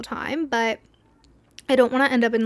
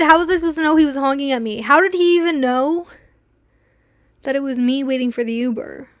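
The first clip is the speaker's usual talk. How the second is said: exasperated rant; 2 semitones higher, 6 dB louder than usual. Real, not acted. Exasperated rant about honking.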